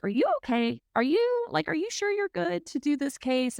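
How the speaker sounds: mock-anxious check-in, voice climbing; playful imitation of a worried friend asking if she's okay; concerned friend. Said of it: "Bailey was like".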